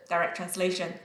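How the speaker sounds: usual speaking voice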